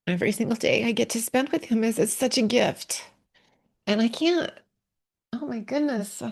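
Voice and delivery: tearful, voice catching and rising; fighting back tears over her husband; real emotion breaking through; choked up. Real, not acted. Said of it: "I'm so emotional".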